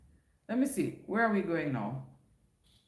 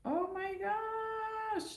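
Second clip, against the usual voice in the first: high, slow drawn-out gasp of surprise; shocked by the flood of missed comments; surprised; 6 dB quieter, much slower than usual. Real, not acted. Vlogger spots unread chat comments, drawn-out surprised oh my gosh.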